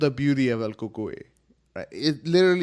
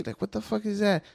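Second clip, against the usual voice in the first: mock disbelief; 10 semitones higher than usual. Retold own confused reply.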